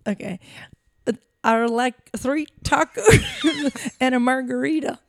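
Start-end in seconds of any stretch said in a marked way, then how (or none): 1.4-5.0 higher, louder, badly faked Irish; attempted Irish accent. Shown as a demonstration. Co-host testing accents on a taco order, shaky Irish attempt. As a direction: giggle through a hammed-up Irish accent.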